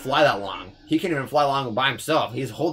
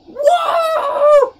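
long, very high, loud "whoa"; piercing surprised holler; startled yell; 11 dB louder than usual. Real, not acted. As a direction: cry out in a drawn-out high falsetto. Startled yell during game.

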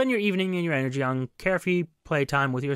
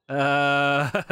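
normal talking voice

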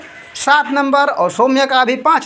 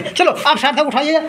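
ordinary talk, nothing marked

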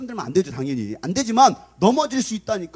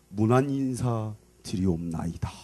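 low, soft, slow courtly greeting; bow with the voice, low and slow; courtly prince; 9 semitones lower, 5 dB quieter, slower than usual. Acted. Prince paying morning respects to the king, courtly voice. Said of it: "문안 인사를 드릴 거 아닙니까".

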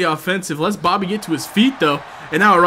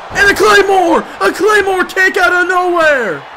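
high, loud play-by-play yell; excited shout; 9 semitones higher, 4 dB louder than usual. Real, not acted. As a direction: erupt as the kick lands. Big finishing move lands.

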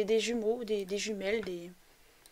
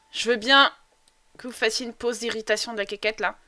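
ordinary delivery

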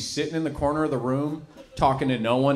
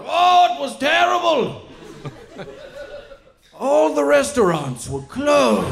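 higher, slow, shouted mock tragedy; dramatic lament; 12 semitones higher, 6 dB louder, slower than usual. Old comic tells grandkids, mock-tragic shout. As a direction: wail it like a war veteran.

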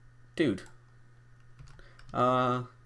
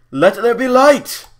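much higher, louder and slow, like a god speaking; mock grand proclamation; 11 semitones higher, 11 dB louder, slower than usual. Gamer lighting a cave for viewers, booming mock proclamation. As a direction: boom it out as a ridiculous divine decree. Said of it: "I am trying to be a little bit more ridiculous".

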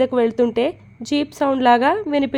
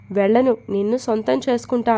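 ordinary delivery

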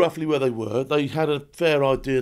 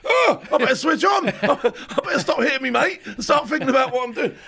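startled realisation; 9 semitones higher than usual. Retold or imitated. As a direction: snap out of it with a laugh. Jolted out of his frenzy.

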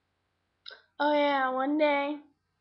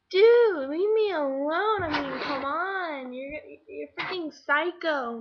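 loud, drawn-out retold protest, easing off; exasperated rebuff; 7 dB louder, slower than usual. Girl telling her stalker off, exasperated. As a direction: stretch each word in fed-up annoyance.

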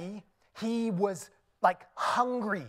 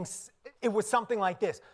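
ordinary talk, nothing marked

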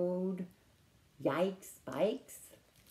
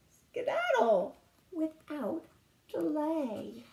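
mama's voice; 6 semitones higher, 5 dB louder than usual. Acted. Mama toad voiced in story.

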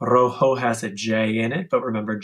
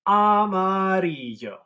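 armadillo voice; 7 semitones higher than usual. Shown as a demonstration. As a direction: draw it out playfully.

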